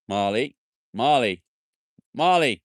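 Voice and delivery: loud, rising call across the yard; calling the dog. Real, not acted. Owner calling his dog Marley, loud call. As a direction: call the dog back firmly.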